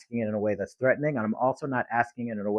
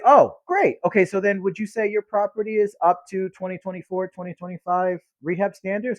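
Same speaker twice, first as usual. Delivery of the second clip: upbeat sales pitch; 7 semitones higher than usual. Shown as a demonstration.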